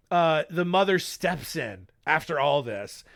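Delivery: ordinary delivery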